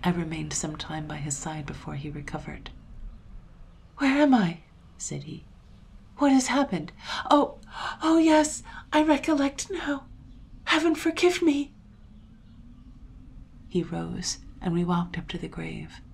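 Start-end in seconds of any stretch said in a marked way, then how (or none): 4.0-4.6 high, loud bewildered question; startled, disoriented cry; dazed alarm. A narrator's reading. Father waking from a swoon. 6.2-11.7 high, loud, anguished outcry; distressed cries, pitched high throughout; distraught recollection. A narrator's reading. Father recovers, recalls shooting his wife.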